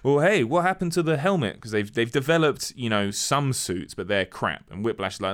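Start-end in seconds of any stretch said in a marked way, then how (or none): 0.0-1.6 high-pitched mock indignation; retold boss's put-out query; indignant questioning. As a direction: demand an answer, voice pitched up. Retold boss confronting his engineer.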